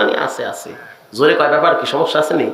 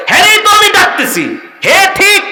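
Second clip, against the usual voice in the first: shouted retort; 9 semitones higher than usual. Retold or imitated. Preacher voicing an exasperated shout about fixing the house.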